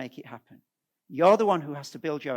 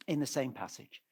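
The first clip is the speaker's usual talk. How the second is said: usual speaking voice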